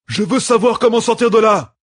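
desperate cry to the fleeing woman; shout. A narrator's reading. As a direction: demand a way out at full voice. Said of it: "cria Perrine".

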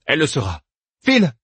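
high, sharp dismissal; high-voiced order to get going; curt command. A narrator's reading. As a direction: lift the pitch and send him off on the last word.

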